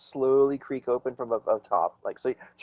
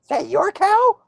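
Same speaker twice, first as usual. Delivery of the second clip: loud, high-pitched creature squawk; mock griffin's voice; 7 dB louder, slower than usual. Mock griffin questions the traveler about the cow, high squawk. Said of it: "He's all".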